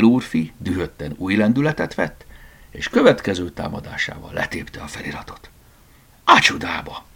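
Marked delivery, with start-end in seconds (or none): 6.2-7.0 high, mildly acted outcry; a storyteller's startled curse; exclaiming. A narrator's reading. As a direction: exclaim in sudden dismay. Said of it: "kiáltott fel Tivadar".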